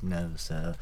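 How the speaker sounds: a hint of a quiet snooty waiter; just a lightly voiced haughty refusal; mild snooty waiter. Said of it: "snooty waiter ... just goes".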